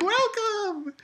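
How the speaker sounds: high, sing-song welcome; imitating cheerful girls; mock hostess welcome. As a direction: greet like a club hostess. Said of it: "And it's like".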